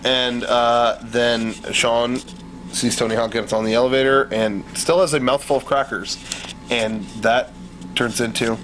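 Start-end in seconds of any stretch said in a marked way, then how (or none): none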